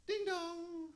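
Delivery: high, soft chiming ding dong; very high-pitched bell voice; doorbell imitation. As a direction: chime it like a doorbell.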